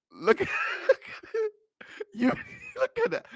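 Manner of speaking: high, squeaky cracking-up laughter; laughing in disbelief. Real, not acted. Commentator shows viewers her sprint, laughing in disbelief. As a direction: barely get the words out for laughing.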